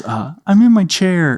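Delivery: put-on high little-kid reply; Adam. Little boy answering dad from his chair, high child voice. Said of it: "And he goes".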